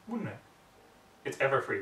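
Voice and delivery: ordinary delivery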